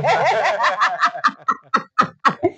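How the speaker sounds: long, rapid burst of laughter; real drawn-out laughing fit. Real, not acted. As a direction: laugh on and on in quick pulses. Laughing at her reply.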